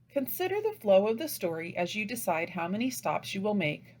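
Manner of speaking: normal talking voice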